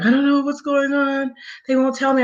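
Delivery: high, plaintive retold distress; imitated crying. Brother's fiance waking her in the night, imitated crying. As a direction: mimic a tearful plea, pitched well up. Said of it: "she's crying".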